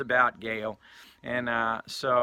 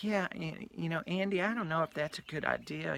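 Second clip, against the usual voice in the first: softer, raised-pitch imitation of a woman; hesitant doubt; 4 semitones higher, 8 dB quieter than usual. Storyteller voicing Donna doubting Andy's plan, soft higher hesitant voice. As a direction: voice gentle, unsure objection.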